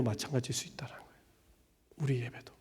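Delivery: fast unvoiced whisper. Real, not acted. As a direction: drop to a whisper. Sermon point whispered.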